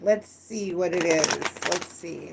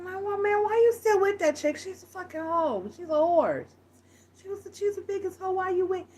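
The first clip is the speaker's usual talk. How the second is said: higher put-on voice, lightly scornful and blunt; mock scornful friend; 10 semitones higher, 5 dB quieter than usual. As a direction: needle your buddy about his girl. Friend insulting the girlfriend.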